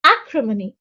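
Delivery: "Acrimony" with a strong first syllable; stress on first syllable. Shown as a demonstration. Teacher introducing a vocabulary word, first syllable of "Acrimony" stressed. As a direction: hit the opening syllable of "Acrimony", then ease off. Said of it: "The stress is on the first syllable".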